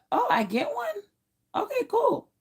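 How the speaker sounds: very high, pleased little voice; high-pitched acted delight; happy, excited surprise. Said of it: "I'm all happy and excited".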